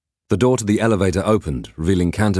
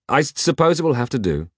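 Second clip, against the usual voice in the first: higher, grudging huff; huffy; 8 semitones higher than usual. Acted. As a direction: huff it out reluctantly. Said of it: "huffed Peg quickly".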